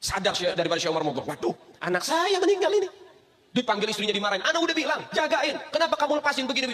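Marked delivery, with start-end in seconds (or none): 1.3-2.9 much higher, half laughing; a shocked, high wail of dismay; dismayed cry. Acted. Father realizes his child is dead, high dismayed cry. 4.4-6.7 higher, reproachful scolding. Acted. Husband scolds his wife for letting the child go, higher.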